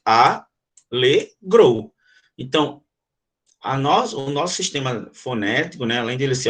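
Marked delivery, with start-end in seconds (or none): 0.0-1.9 "Alegrou" very slow, split into a-le-grou; syllable by syllable. Shown as a demonstration. Teacher breaking a word into syllables, very slowly. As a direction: say "Alegrou" in three slow blocks. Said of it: "tem três blocos".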